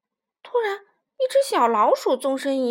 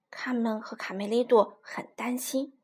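ordinary delivery, nothing marked

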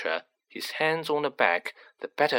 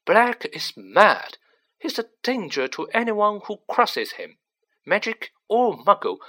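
irritable official, higher-pitched; testy self-justification in a raised pitch; 6 semitones higher, 3 dB louder than usual. A narrator's reading. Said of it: "said the irritable Fudge".

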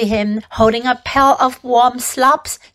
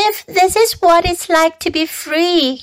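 lightly voiced, higher piglet thought; a touch of dismay, pitched up; troubled young pig; 6 semitones higher than usual. A narrator's reading. Frightened pig thinks to himself, lightly dismayed. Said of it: "he thought".

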